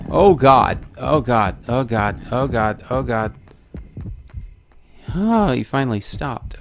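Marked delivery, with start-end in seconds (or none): 0.1-3.3 panicked repeated gasps of Oh God, fading; real fright, blurting it over and over; terrified gibbering. Real, not acted. Chased by monster, repeating in panic.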